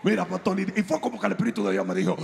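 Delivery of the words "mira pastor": hammed-up excited congregant; excited churchgoer. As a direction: gush at the pastor like a breathless fan. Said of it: "y te dice".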